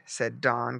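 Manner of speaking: ordinary delivery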